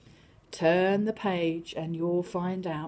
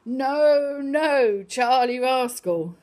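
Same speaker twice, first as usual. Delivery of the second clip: loud scolding shout; teacher shouting; 3 semitones higher, 8 dB louder, much slower than usual. Acted. Teacher shouts at Charlie in classroom, loud scold. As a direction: call the cat off, loud and firm. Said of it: "why our teacher had to shout".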